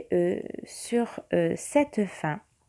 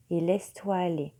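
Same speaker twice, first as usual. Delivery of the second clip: right in your ear. Acted. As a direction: guide them gently into calm. Leads a meditation. (voix douce de méditation)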